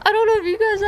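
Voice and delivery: laughing, high and fast. Real, not acted. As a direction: giggle through the story. Laughing at cat jump.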